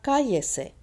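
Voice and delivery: loud, high "cá" in "cállese", then falling; stress on CA. Shown as a demonstration. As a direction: punch the first syllable of "cállese". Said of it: "Still on the ca".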